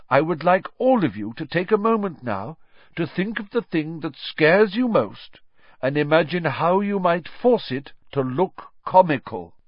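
encouraging teacher, pitched well above narration; Professor Lupin teaching. Acted. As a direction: explain to the class with emphatic key words.

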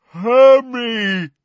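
high, loud, drawn-out roar; giant roar. A narrator's reading. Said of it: "roared Grawp".

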